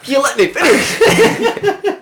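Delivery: high, loud, drawn-out cry through laughter; laughing, shrill-pitched objection; laughing protest. Real, not acted. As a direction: squeal in mock outrage at being cut off. Interrupted while reading his news, protests.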